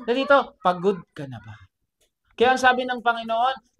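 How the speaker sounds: ordinary talk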